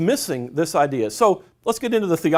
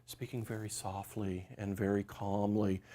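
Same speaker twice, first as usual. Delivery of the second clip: very soft, hushed and gentle; soft and calm; 7 semitones lower, 16 dB quieter than usual. Shown as a demonstration. Speaker mimics movie Jesus, speaking soft and calm. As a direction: murmur it softly like a calm teacher on a rock. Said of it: "Jesus sitting on the rock, speaking very softly".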